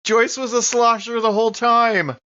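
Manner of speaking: high, drawn-out astonished cry; astonished revelation. Acted. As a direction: react to a huge plot twist. Reacting to a comic plot revelation.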